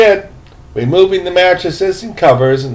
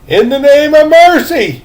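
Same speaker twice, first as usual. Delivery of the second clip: a higher, louder gurgling cry; gurgling old man begging for mercy; gurgled plea; 9 semitones higher, 6 dB louder than usual. A narrator's reading. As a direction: choke it out. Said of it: "he gurgled".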